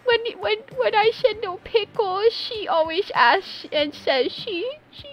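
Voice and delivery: high put-on voice, rambling and halting; imitating a whiny character. Streamer re-enacting a favorite part, high mimicking voice. Quotes a favorite funny moment.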